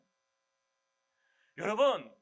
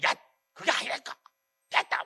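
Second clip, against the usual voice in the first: louder, clipped-off Gyeongsang brush-off, breathy and rough; curt Gyeongsang retort; 3 semitones lower, 5 dB louder than usual. Retold or imitated. Pastor imitating his own blunt Gyeongsang phone talk, curt and louder. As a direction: bluntly cut the caller off in Gyeongsang style. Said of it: "이 말투가 얼마나 센지".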